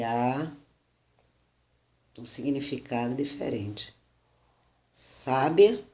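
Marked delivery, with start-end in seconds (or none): 4.9-5.8 Sábia with stress on SÁ, a little louder; stress on first syllable. Shown as a demonstration. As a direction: say Sábia as SÁ-bia. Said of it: "no primeiro A".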